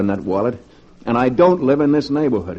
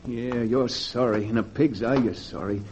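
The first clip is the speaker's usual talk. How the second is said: bitter muttering; 4 semitones lower, 10 dB quieter than usual. Acted. Bitter muttering alone on street.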